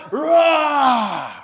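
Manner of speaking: a loud mock roar; lion roar. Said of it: "And he's roaring".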